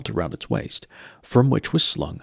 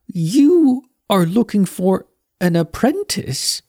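much higher, astonished question; dawning amazement, boy voice; amazed young Mort; 10 semitones higher, 5 dB louder, slower than usual. Acted. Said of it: "he said".

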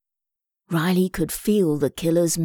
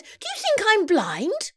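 very high incredulous question; scornful declaration; 13 semitones higher than usual. A narrator's reading. Mum rejects the idea of Eric, scornful. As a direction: toss it back, pitched way up. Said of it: "Mum declared".

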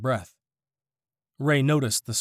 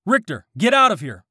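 higher, urgent yelled order; yelling; 7 semitones higher, 4 dB louder than usual. A narrator's reading. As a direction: shout the warning across the fight. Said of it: "Christy yelled".

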